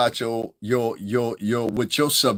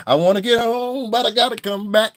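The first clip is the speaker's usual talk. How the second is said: higher, put-on wig voice; high whining wig; whiny wig voice; 8 semitones higher, 3 dB louder than usual. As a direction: let the wig complain. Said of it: "Now back to Lauren".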